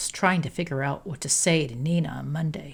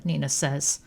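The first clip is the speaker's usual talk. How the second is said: usual speaking voice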